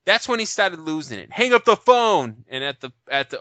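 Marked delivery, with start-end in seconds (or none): 1.3-2.4 higher, louder, slow theatrical command; hammed-up storyteller shout; dramatic storybook reading. Shown as a demonstration. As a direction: read it like a bedtime-story villain. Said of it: "I read stories to my kids".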